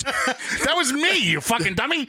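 squeaky mock insult; high-pitched teasing.

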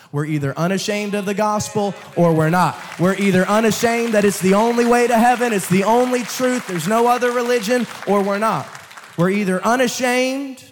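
loud, high preaching build; preacher driving his point to the congregation, loud; fiery preaching. Acted. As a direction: hammer it home from the pulpit. Preaching at full force.

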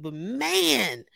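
ordinary delivery

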